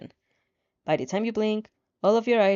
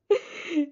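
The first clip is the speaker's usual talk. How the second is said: short laugh; 7 semitones higher than usual. Real, not acted. Narrator laughs at own line.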